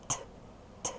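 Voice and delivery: Т, т as two short voiceless bursts with a pause; voiceless consonant. Shown as a demonstration. Teacher isolating a sound for pupils, Т, т short and voiceless. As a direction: tap out Т, т with no vowel after. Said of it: "глухий".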